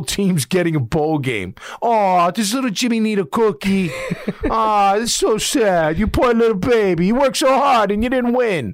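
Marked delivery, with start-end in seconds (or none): 1.8-8.7 coddling, condescending coo at a crybaby; sarcastic sympathy for a whining kid; mock baby talk. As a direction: pat them on the head with fake pity, a little higher. Mocking teams that want bowls.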